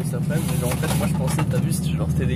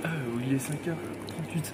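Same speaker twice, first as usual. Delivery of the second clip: ordinary talk, nothing marked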